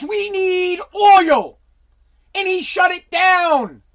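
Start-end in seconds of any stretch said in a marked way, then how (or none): 0.0-1.6 wailing shout. Retold or imitated. Mock cry, climax of rant.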